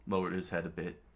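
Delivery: plain reading voice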